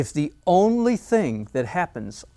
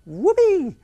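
whooped high and loud; a gleeful child's cheer; childlike whoopee; 7 dB louder than usual. Retold or imitated. Good artists' inner child cheering, high whoop. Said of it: "the child in them still goes".

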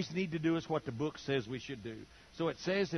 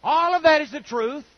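repeated louder and higher, rising on that; emphatic repeat; 9 semitones higher, 11 dB louder than usual. Shown as a demonstration. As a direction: hammer the point home the second time. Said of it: "but it doesn't take First Corinthians".

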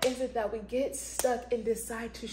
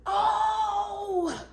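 higher, loud overflowing praise; excited outburst; 10 semitones higher, 5 dB louder, much slower than usual. Real, not acted. Preacher overcome with excitement, praising God aloud. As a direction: let the joy burst out. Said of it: "this how you know you get excited".

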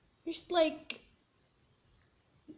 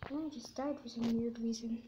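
whispering; 5 semitones lower, 8 dB quieter than usual. Real, not acted. Died in game.

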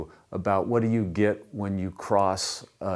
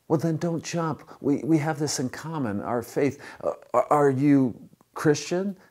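eager, higher-pitched appeal; acting out a hopeful rescuer, voice raised in pitch; eager pleading; 7 semitones higher, 4 dB louder than usual. Rescuer finds common faith with the jumper, eager pleading.

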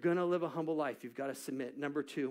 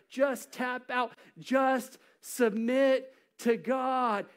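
slow pleading urge; 7 semitones higher, 4 dB louder, much slower than usual. Retold or imitated. Urging to surrender.

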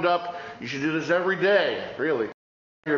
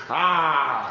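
wide open sustained ah; 4 dB louder than usual. Shown as a demonstration. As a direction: open the face and sing ah. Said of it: "Now wide, open your face".

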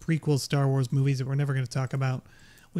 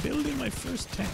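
high, softer put-on voice; jokey high mimicry of an old video; mock high voice; 11 semitones higher, 4 dB quieter than usual. Mimics his old video title.

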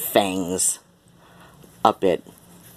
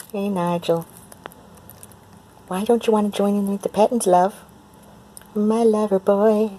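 high, fast coaxing coo; 11 semitones higher than usual. Real, not acted. Owner coaxing her cat Nigel to join. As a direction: coax the shy cat in.

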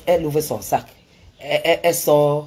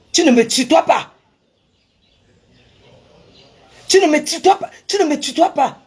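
much higher, loud indignant protest; angry retort; 11 semitones higher, 6 dB louder than usual. Retold or imitated. The woman objecting to being addressed as tu, loud outraged protest. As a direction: forbid her from using tu, outraged.

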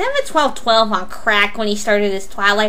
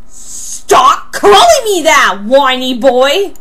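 angry quarrel; 2 semitones higher, 6 dB louder, slower than usual. Acted. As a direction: raise your voice and drag out the taunt. Acted name-calling quarrel.

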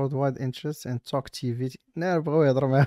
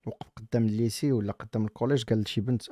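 usual speaking voice

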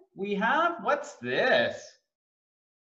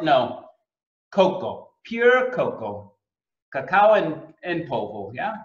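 ordinary talk

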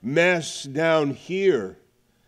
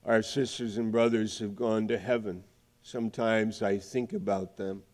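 hushed reflection; 5 semitones lower, 7 dB quieter than usual. Real, not acted. Hushed turn after scripture.

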